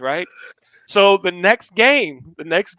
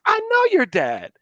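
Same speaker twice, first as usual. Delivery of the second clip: high-pitched burst of recognition; coach's recognition; 14 semitones higher than usual. Retold or imitated. Coach learns who the student's father is, excited recognition. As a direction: jump up high in pitch on realizing it.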